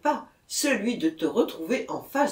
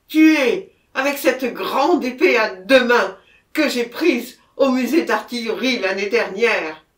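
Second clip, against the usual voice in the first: loud, raised voice of a desperate confession; frantic outburst; 5 semitones higher, 10 dB louder than usual. A narrator's reading. Murderer refusing to go to the scene, frantic outburst. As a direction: protest at full voice, beside yourself.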